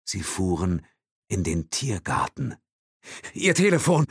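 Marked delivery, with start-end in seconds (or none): 3.3-4.1 high, loud demand. A narrator's reading. Will demands Thompson's phone, high and loud. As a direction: insist on it. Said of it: "forderte er".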